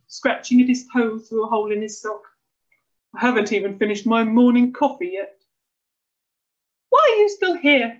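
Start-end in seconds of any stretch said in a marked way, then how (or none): 3.1-5.4 loud, grumpy protest; grumbling husband. A narrator's reading. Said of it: "Starling grumbled". 6.9-7.9 high, loud, cross wife; hissed scolding. A narrator's reading. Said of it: "hissed his wife".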